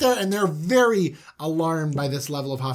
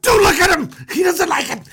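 high, loud frantic scolding; urgent high-pitched telling-off; panicked scolding; 13 semitones higher, 8 dB louder than usual. Acted. Brothers scold glaring Benjamin.

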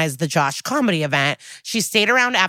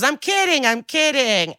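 higher-pitched imitation of joking retraction; retold protest, pitched up; mock backpedalling; 8 semitones higher than usual. Comic backpedals to the heckler in a higher mock voice.

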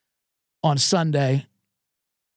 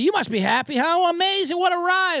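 excited friends; 10 semitones higher than usual. Retold or imitated. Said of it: "my reaction is like".

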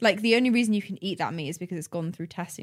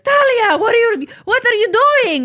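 shrill high loud calling in a mock Italian nonna voice; Italian grandma; 11 dB louder than usual. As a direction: yell at the kid as a hammed-up Italian grandma. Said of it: "she was like".

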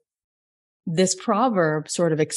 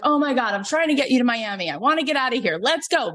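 frustrated pleading; 7 semitones higher, faster than usual. Retold or imitated. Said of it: "so I get so frustrated".

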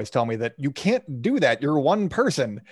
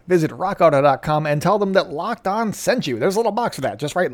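upbeat, louder sponsor read; 6 semitones higher, 6 dB louder than usual. Acted. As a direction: sell it like a radio spot.

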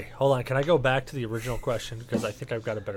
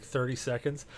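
ordinary talk, nothing marked

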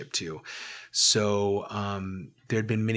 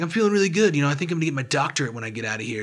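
high, loud and fast, mock-cheerful self; playing his deluded younger self, breezy and upbeat; manic overconfidence; 6 semitones higher, 7 dB louder, much faster than usual. Mimicking his manic hospital self.

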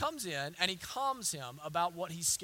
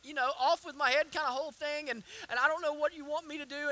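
acted anxious rambling, slightly raised pitch; words outrunning mind; 6 semitones higher than usual. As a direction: stumble onward like a worried man's mock protest. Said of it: "his words beginning to outrun his mind".

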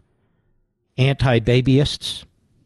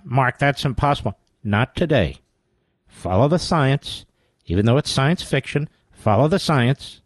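ordinary talk, nothing marked

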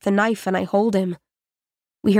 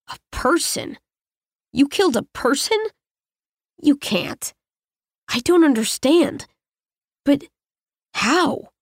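higher, halting disbelief, the first words breathed; stunned stammering; 5 semitones higher than usual. Acted. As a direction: stammer it out in shock, pitch climbing. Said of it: "While he stammers".

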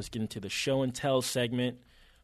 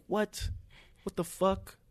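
incredulous; 9 semitones higher, slower than usual. Retold or imitated. Retelling disbelief at search results.

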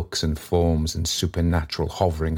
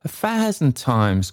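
higher-pitched eager exclamation; crying out; 7 semitones higher than usual. A narrator's reading. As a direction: answer keenly, voice lifted high. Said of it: "cried Trotty".

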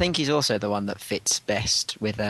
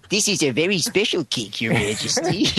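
higher put-on servile voice; character imitation; 6 semitones higher than usual. Co-host imitating Chef Allen with the cake, character voice. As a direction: present it to royalty in a silly, lilting character voice. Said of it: "that's an excellent invitation".

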